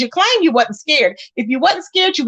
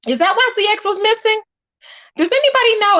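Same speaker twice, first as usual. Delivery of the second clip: teasing; 8 semitones higher than usual. Acted. Mocking questions to ZX.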